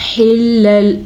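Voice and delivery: slow melodic tajweed chant; Quran recitation. Shown as a demonstration. Teacher demonstrates the verse for students, slow chant. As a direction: draw each word out in a chant.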